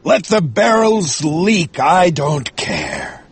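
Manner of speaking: slow, dragged-out indifference; put-on captain voice, drawn out and uncaring; dismissive captain. A narrator's reading. Captain brushes off the leaking barrels, dismissive.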